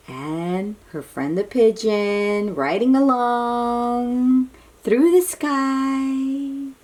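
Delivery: plain reading voice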